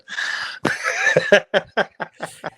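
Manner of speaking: hearty burst of laughter; real, helpless laughing fit. Real, not acted. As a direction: laugh hard at your buddy. Amused by teammate's crossbar excuse.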